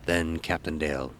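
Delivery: plain reading voice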